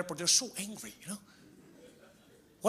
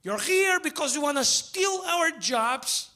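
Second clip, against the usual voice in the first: furious local; 9 semitones higher than usual. Retold or imitated. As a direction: imitate the raging guy at the store. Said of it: "He got furious at me".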